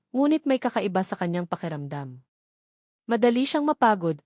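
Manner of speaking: plain reading voice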